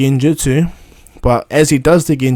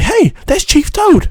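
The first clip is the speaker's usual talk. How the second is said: put-on high, slow, excited boy's cry; excited kid shout; slower than usual. Naruto spotting the giant toad, excited high shout. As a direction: call out in surprise at the giant toad. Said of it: "Naruto's like".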